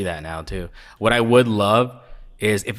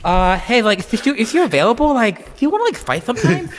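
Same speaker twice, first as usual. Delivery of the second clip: much higher shy, hesitant asking; timid high voice; 12 semitones higher, 3 dB louder than usual. Retold or imitated. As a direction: meekly ask like a nervous kid. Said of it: "He's like".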